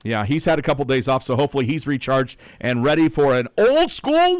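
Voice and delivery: radio announcer read. Acted. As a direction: read the weather to listeners on air. On-air show promo.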